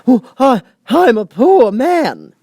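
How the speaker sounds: high, loud, slow quavering plea; trembling Hatter. A narrator's reading. Hatter pleads with the King, trembling high and slow. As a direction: beg for mercy, voice shaking.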